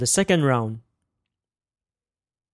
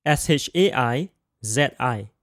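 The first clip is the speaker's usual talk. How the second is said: ordinary talk, nothing marked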